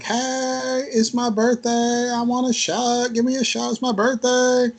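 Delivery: put-on high, drawn-out tipsy girl's plea; mock drunk girl pleading for a shot; birthday girl begging. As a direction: whine for a free birthday shot, pitched high. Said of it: "one girl's like".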